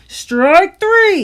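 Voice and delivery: very loud high umpire call; yelling umpire. A narrator's reading. Umpire yells the strike call, very loud and high. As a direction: call it for the whole stands. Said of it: "yelled the umpire".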